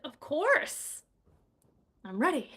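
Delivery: keen and eager. Shown as a demonstration. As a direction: sound ready to go. Eager reading demo.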